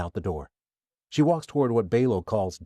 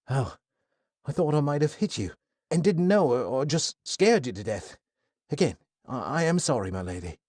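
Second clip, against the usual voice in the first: put-on higher, fretful apologetic young man; flustered young man; 6 semitones higher than usual. Kai apologizing to Athena after nearly hitting her, flustered.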